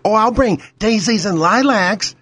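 slightly put-on candidate's sugary promise; mildly mocking a candidate's empty promise; mock-sweet candidate. Mocks a candidate's empty answer.

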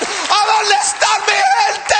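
high, slow, shouted call to the crowd; rally his people with a big shout. Real, not acted. Comic calls out to Latinos in crowd, shouting.

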